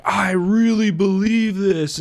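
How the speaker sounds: slow, drawn-out show of conviction; mock grandstanding. Mocking an opponent who pumps his chest. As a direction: ham up a fake heartfelt declaration. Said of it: "grandstand and be like".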